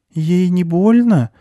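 put-on high, slow little girl's voice; cautious girl. A narrator's reading. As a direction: ask hesitantly, high and drawn out. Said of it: "осторожно спросила девочка".

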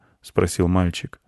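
ordinary delivery, nothing marked

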